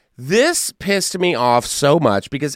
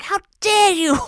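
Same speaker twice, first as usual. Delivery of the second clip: much higher mock-outraged activist voice; Greta Thunberg impression. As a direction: scold like a furious young activist. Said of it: "I'm sure Greta Thunberg was like".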